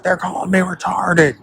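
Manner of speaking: high, whiny put-on voice for the politician; a high mock whine; mocking Tim Walz. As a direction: squeak it out like a wounded politician. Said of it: "He's like".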